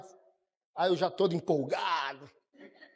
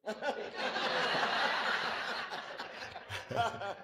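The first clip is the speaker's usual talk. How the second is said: stifled laugh; 6 semitones higher, 10 dB quieter than usual. Real, not acted. Officer holding back laughter.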